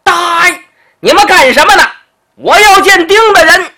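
loud high call across the hall, hands cupped. Acted. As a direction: shout up to the guards at the top of the steps. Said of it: "拿手一拢音". (拢着手高声喊话)

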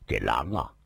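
plain reading voice

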